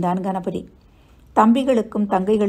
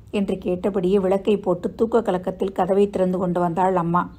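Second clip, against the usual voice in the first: plain reading voice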